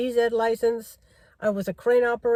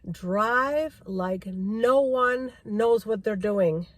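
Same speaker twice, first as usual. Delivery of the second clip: quoting instructor; much slower than usual. Retold or imitated. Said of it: "He said to me".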